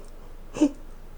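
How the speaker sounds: acted hushed gasp; small amazed intake of breath; mimicked hopeful gasp. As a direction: gasp as if daring to hope. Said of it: "looked at each other like".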